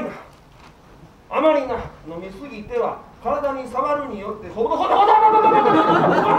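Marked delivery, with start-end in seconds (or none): 4.5-6.3 fast laughing babble. Acted. Scolding bride, bursts out laughing.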